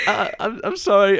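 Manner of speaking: high-pitched, stammered mock apology; embarrassed, near tears. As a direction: act out a flustered, tearful little sorry. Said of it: "wanted to cry at that moment".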